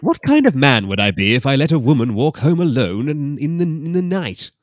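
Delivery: loud, high mock brogue; Scottish man. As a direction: ham the gallant Scot.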